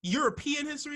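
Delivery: loud incredulous European history; incredulous question again. Retold or imitated. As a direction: press the question, baffled. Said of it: "I'm like".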